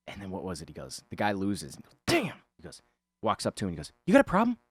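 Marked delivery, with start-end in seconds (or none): 2.1-2.4 much higher, sharp exclamation; frustrated outburst. Retold or imitated. A guy losing at the arcade, shouting in frustration. Retold pizza guy loses. 4.1-4.6 higher and louder, picking a fight; aggressive challenge. Retold or imitated. Pizza guy confronting the stranger, loud and aggressive.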